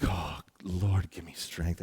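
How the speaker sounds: low, soft exasperated mutter; muttered plea. Retold or imitated. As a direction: pray under your breath for patience. Acted private plea in annoyance.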